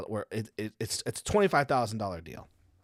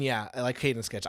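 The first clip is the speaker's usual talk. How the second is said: ordinary talk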